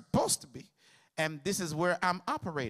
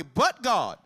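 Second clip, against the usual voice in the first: "But God" cried out loud in mock testimony; mock testimony shout; 8 dB louder than usual. Sister testifying to the church, a loud cry.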